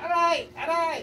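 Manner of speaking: high mock cries of pain; imitating the pained voice. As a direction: mimic the strange voice from earlier. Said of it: "di ba yung boses na kanina". (ginagaya ang boses na umaaray)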